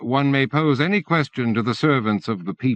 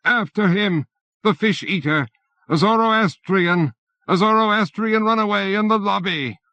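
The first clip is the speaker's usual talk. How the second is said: alarm shout; 10 semitones higher than usual. A narrator's reading. Bureaucrat raising the alarm in the lobby, frantic shout. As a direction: rouse the building after him.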